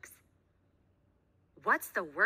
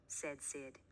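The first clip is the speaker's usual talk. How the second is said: plain reading voice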